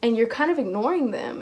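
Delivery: usual speaking voice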